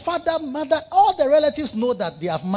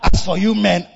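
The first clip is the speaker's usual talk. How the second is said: mock shout; 7 dB louder than usual. Mock complaint about men.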